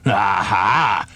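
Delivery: knowing crocodile aha; sly aha. A narrator's reading. Crocodile about to reveal his meal, sly aha. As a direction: pounce on the idea. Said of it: "said the enormous crocodile".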